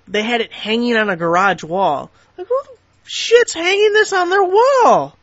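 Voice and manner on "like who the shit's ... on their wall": high, loud, slow disbelief; mock the idea in a squeaky outraged voice; incredulous outburst. Real, not acted. Ridiculing trailer hanging photo.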